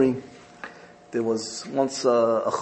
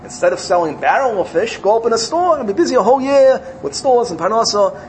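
a light, coaxing tempter voice, a little higher; tempting Yetzer Hara; 7 semitones higher, 5 dB louder than usual. Acted. The evil inclination tempts the chassid, lightly voiced and coaxing. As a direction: sweet-talk him into the store idea. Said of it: "the Yetzer Hara will come and say".

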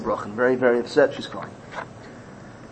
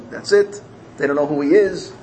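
plain reading voice

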